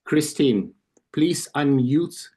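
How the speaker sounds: ordinary talk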